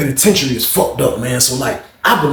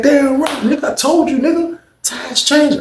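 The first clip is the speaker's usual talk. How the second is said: mock cellmate voice; 12 semitones higher than usual. Retelling cellmate's boast.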